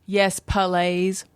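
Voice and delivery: slow, drawn-out put-on Southern twang; twangy Southern accent. Guest guessing at friend's twangy words, mock Southern accent. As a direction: stretch it out like a Southern drawl. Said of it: "Was it like".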